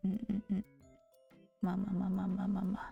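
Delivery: normal talking voice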